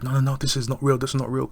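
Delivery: high, loud, rapid-fire protest; panicked denial. Retold or imitated. Voicing Stephen refusing to believe the creature attack, mock panicked.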